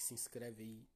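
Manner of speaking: ordinary delivery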